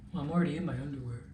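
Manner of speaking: fast, soft grumbling reply; boyfriend excuse. Retold or imitated. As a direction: rattle it off quietly as an excuse. Boyfriend's excuse, quoted unannounced.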